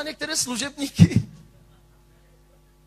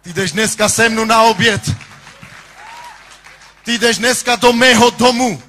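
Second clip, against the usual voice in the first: preaching at full force; 4 semitones higher, 9 dB louder than usual. Acted. Preacher to the church about valuing gifts, full force. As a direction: preach it to the back row.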